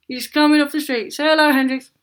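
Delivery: loud and high, calling the cat over. Real, not acted. Owner calling her cat to greet viewers, loud and high. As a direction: raise the voice to get the cat's attention.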